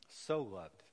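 So leaned on, then loved; stress on so. Shown as a demonstration. Preacher pointing out God's emphasis, stressing So. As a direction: press hard on the word So. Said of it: "God is trying to put emphasis there".

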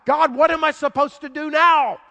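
loud, high desperate plea; desperate loud cry to God. Acted. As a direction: Moses crying out to God, at full voice. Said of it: "cries out to God".

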